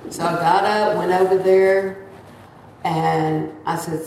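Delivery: ordinary talk, nothing marked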